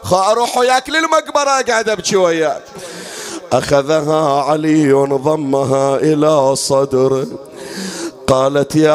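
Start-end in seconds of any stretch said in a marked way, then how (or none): 0.0-2.6 put-on high, weeping chant of a little girl calling her father; a daughter wailing for her father, high and tearful; orphan girl lament. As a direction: cry for the father like a lost child. Child Zainab crying for father.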